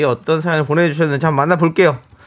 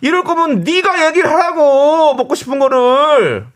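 high, slow, drawn-out scolding; exasperated mock yell; 11 semitones higher, 3 dB louder, slower than usual. Host as fed-up wife to husband over dinner, exasperated mock yell. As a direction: stretch each phrase in hammed-up exasperation.

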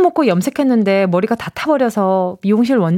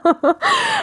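squealing laugh; high burst of laughter. Real, not acted. Cracks up at the text.

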